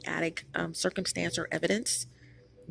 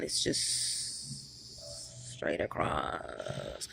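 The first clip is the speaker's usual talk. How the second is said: much lower, much softer, very slow, unvoiced and dead level; flat monotone demo; 10 dB quieter, much slower than usual. Shown as a demonstration. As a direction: drag it out flat in one line. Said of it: "his voice was not made to go up or down".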